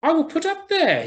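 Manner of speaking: high, loud bird cry; swallow crying out. Acted. Swallow choosing the statue, crying out high. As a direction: call it out up high. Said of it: "he cried".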